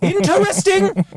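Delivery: high, slow, loaded 'interesting'; mock incredulity. Mocking the posh put-down, high incredulous voice. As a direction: throw the word back with exaggerated disbelief.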